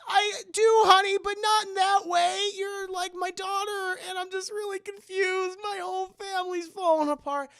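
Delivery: high, wailing mock anguish; imitated distraught dad. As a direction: whine it high as a father falling apart.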